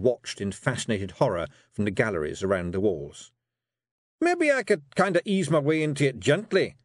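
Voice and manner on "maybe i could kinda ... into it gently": high, louder pleading Scots wee man; a nervous Feegle begging off the task; protesting Feegle. Acted. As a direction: Rob Anybody protesting to his wife. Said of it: "he protested".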